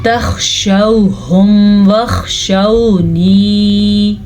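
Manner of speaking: slow chant, heavy kh in تَخْشَوْهُمْ; full throaty kha. Shown as a demonstration. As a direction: recite تَخْشَوْهُمْ with a full scraped kh. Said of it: "خا کے مخرج کا دھیان بھی رکھنا ہے". (تَخْشَوْهُمْ میں خا پر کر کے)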